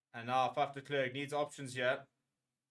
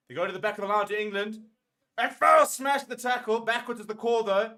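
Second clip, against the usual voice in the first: higher and louder, yelling at the screen; excited shout; 6 semitones higher, 8 dB louder than usual. Real, not acted. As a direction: shout the tackle like a fan going wild. Tense final minutes, shouting at TV.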